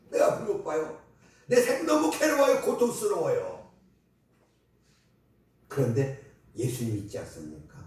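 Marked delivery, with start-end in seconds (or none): 0.1-3.7 a touch of anguished pleading, higher; lightly voiced suffering plea; pleading blind man. Preacher voices the blind man's pleading lament. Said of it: "blind man's plea voiced".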